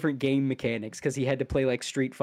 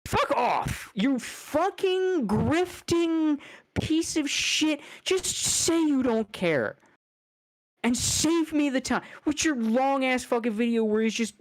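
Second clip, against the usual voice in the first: much higher, slow, furious shriek at the YouTuber; hammed-up high-pitched rage; high mock outrage; 9 semitones higher, slower than usual. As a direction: scream it at him, stretched out and shrill with disgust. Ranting at the grifter.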